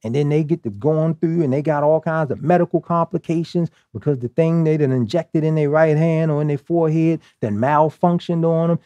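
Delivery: ordinary talk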